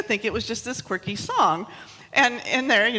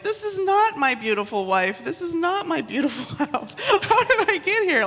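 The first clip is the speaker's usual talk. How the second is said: mock despairing mutter; 8 semitones higher than usual. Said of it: "muttering to myself".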